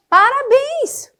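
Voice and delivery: drawn-out, high, ironic "Parabéns!"; mock-cheerful ironic "Parabéns!"; sarcastic congratulations. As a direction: sing out "Parabéns!" like a mother who means the opposite. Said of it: "A mãe falando pro filho".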